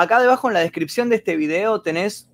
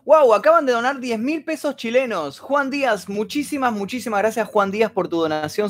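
excited thanks; 7 semitones higher than usual. Real, not acted. Surprised big donation.

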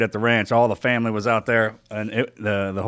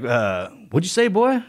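mock challenge; 4 semitones higher than usual. Dad mock-challenging his son.

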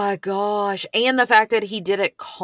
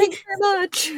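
high, laughing fake politeness; mock sweet thanks; 10 semitones higher, much faster than usual. Co-host joins the joke, sweet fake thanks. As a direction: play along in a sugary put-on voice. Co-host plays along sugary.

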